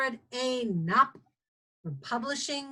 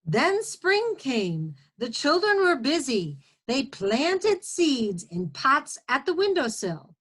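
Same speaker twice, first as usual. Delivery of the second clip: storytime narration for first graders; reading to children; 3 semitones higher than usual. A narrator's reading. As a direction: read it as a guinea pig's tale. Reads picture book aloud.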